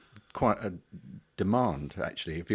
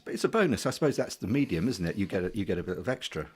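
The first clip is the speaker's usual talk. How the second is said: ordinary delivery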